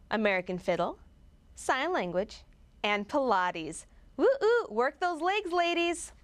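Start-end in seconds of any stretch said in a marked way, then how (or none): 4.1-6.1 high whooping cheer. Acted. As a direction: egg the ladies on with a big woo. Cheering on the Pilates class.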